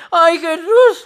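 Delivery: loud high yelps, mouth on fire; mouth burning from spicy food. Acted. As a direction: cry out, burnt by chili. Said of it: "Ahora sí me enchilé".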